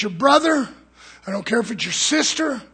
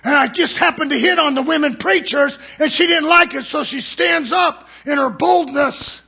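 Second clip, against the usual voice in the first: impassioned preaching; 5 semitones higher, 6 dB louder than usual. Real, not acted. Preaching at full force.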